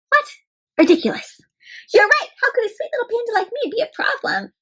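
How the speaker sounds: high, fast sweet innocent voice; high mock-innocent panda voice. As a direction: play the sweet little panda.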